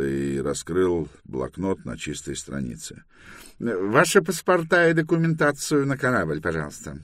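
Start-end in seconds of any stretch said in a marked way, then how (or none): 3.6-7.0 high-pitched official's demand; officious inspector. Acted. Inspector demands passports from the boarded crew, officious.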